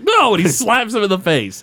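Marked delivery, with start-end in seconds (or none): 0.0-0.3 much higher, louder yelped no; mock angry rebuke; sharp no. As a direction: bark the word at him. Martin rebukes Bart before slapping.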